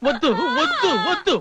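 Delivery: high, urgent repeated refusal; frantic protest. Acted. Husband stopping the visitor's wailing, panicked. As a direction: cut in fast, pitch jumping up.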